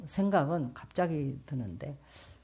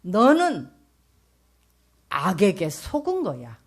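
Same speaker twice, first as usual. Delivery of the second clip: God's verdict; 3 semitones higher, 8 dB louder than usual. Retold or imitated. Speaker voices God's rebuke.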